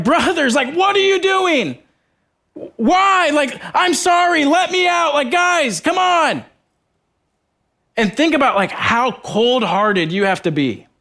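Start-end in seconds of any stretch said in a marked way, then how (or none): 0.0-6.4 much higher, desperate pleading from the pit; pleading Joseph. Pretend. Joseph begging his brothers from the pit, high desperate plea. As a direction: beg your brothers to let you out. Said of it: "pleading for mercy".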